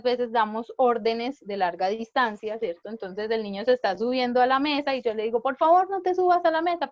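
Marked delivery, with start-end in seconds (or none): 5.4-6.9 raised, higher call across the room; calling from afar. Shown as a demonstration. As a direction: call it out from a distance to the child. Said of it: "pero estoy lejos". (llamado en voz alta desde lejos)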